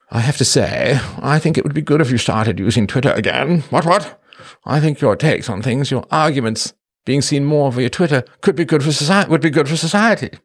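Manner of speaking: pompous British imperialist. Acted. Host reading a viewer's comment aloud, as a pompous British imperialist. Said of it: "nineteenth century British pompous, um, imperialist".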